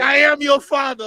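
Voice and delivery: ordinary delivery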